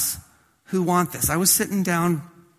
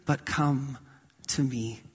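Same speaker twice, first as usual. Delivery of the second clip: hushed invitation; 4 semitones lower, 7 dB quieter, slower than usual. Acted. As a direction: drop to a whisper on "to me". Intimate invitation dropping soft.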